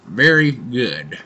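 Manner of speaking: talking around pipe stem; pipe in mouth. Real, not acted. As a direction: lips clenched on pipe. Seen 2: Pipe stem clenched in mouth throughout the labelled words; absent in refs.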